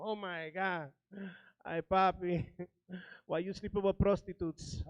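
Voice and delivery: hint of a wailing mom; mock crying mom. Comic retelling of his mom crying on the phone. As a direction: lightly mimic a panicked mother. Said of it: "She started screaming, crying over the phone".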